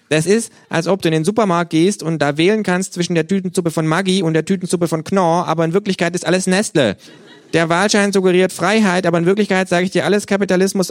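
high, loud ranting kangaroo. Acted. The kangaroo lectures the narrator on fake elections, high and loud. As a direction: lecture like a smug little revolutionary. Kangaroo rant on soup brands.

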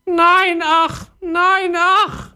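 very high wailing parrot cries. Acted. Parrot lamenting to the boy in a high wail. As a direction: wail it like a homesick little parrot. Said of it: "jammerte der Papagei".

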